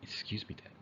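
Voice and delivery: unvoiced whisper; whispered request. Shown as a demonstration. Kid whispering to Teacher Dan, unvoiced whisper. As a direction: breathe the words with no voice. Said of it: "Instead of saying".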